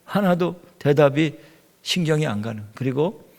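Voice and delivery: ordinary talk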